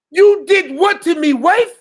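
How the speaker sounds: high, slow, hammed-up outrage; a vengeful father confronting his enemy, in high, slow mock outrage; mock fury. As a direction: spit it at him like a vengeful attacker. Acted attacker confronting victim.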